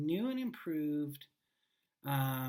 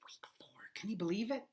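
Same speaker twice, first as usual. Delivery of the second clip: whisper; 6 semitones higher than usual. Pretend. Aside about week four.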